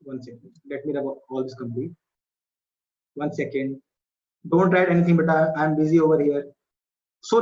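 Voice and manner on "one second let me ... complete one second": quiet aside, turned away from the mic. Real, not acted. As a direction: mutter it off to the side.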